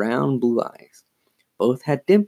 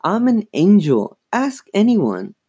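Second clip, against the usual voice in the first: high-pitched mock-innocent claim; wide-eyed innocence; 9 semitones higher, 4 dB louder than usual. A narrator's reading. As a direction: plead angelic innocence in a high voice. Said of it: "in a wide-eyed nu-, nuisance".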